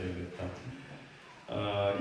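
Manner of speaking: ordinary delivery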